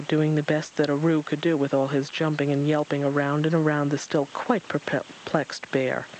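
plain reading voice

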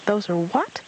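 high squeaky little voice, asking again; high puzzled squeak; squeaky Roo. Acted. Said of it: "Roo squeaked".

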